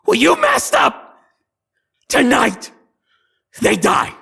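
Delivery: high, loud shrieking threat; triumphant hag shout. Acted. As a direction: scream the doom at them.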